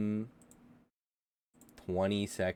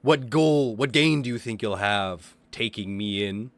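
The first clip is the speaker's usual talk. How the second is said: a raised, indignant captive cult leader; angry leader; 6 dB louder than usual. Acted. Captured leader berating his attacker, indignant and loud. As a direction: demand answers, affronted and loud.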